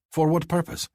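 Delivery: usual speaking voice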